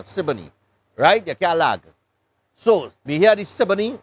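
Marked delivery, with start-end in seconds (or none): 0.0-0.5 said fast. Shown as a demonstration. Showing how locals say the name, "Siboney" fast and low. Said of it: "You had to say it kind of fast now".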